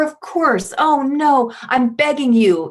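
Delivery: high, whiny, pleading voice; pleading beggar. Retold or imitated. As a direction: beg in a high, desperate whine.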